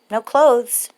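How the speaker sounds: usual speaking voice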